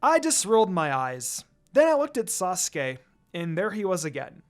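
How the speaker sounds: plain reading voice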